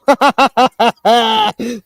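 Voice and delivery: cracking up at her own joke; loud laugh. Real, not acted. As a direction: let a big laugh burst out.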